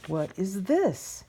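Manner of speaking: slow, mildly awestruck question; wondering. A narrator's reading. Moth opens the door on a world of daylight, wondering. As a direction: draw it out in wonder. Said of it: "he asks in wonder".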